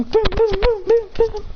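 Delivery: high repeated boom sounds; imitated bell. Giant bell going off, high imitated booms. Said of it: "the giant bell thing rings".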